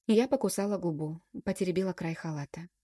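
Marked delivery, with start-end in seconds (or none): none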